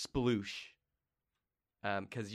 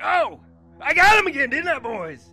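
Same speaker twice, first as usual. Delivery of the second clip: gloating torturer; 14 dB louder than usual. Acted. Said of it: "He's like".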